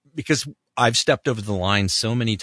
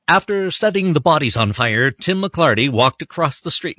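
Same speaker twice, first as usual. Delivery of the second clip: higher, louder documentary narrator read; Bill Curtis voice; 6 semitones higher, 5 dB louder than usual. Retold or imitated. Voice actor showing his Bill Curtis take to the director. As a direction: intone it like a crime documentary host. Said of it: "I did like a Bill Curtis voice".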